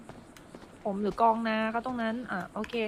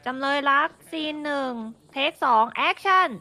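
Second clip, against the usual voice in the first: ประกาศสั่งแอคชั่น; 3 semitones higher, 3 dB louder than usual. Real, not acted. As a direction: call action for the take, crisp and loud. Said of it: "เปิดกล้องนะครับ". (ประกาศเทคแบบผู้กำกับ)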